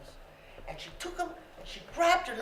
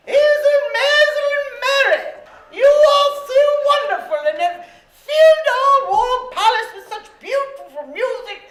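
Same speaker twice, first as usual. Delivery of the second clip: loud, high, grandly hammed-up royal voice; proclaiming Queen; 10 semitones higher, 15 dB louder than usual. A narrator's reading. The Queen honours Mary and Hazel at the palace, grand proclamation.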